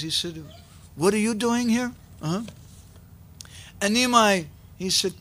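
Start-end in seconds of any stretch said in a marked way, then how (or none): 0.9-1.9 higher-pitched, puzzled surprise; surprised question. A narrator's reading. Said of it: "Murari was very surprised".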